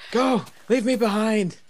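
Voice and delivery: hammed-up heroic yell; dramatic shout. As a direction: shout like a doomed hero. Creepers chasing in game.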